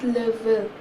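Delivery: le vin said luh van with a nasal end; le vin, the n not sounded; French le vin. Shown as a demonstration. Teacher giving the French word for wine. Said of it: "In French we say it as".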